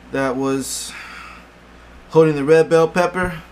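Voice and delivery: out of breath after lifting; breathless, panting between phrases; gasping for air. Real, not acted. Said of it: "sorry, I'm out of breath".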